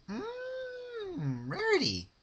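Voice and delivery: high, drawn-out seductive purr; creepy purring voice. A narrator's reading. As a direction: purr it with menace.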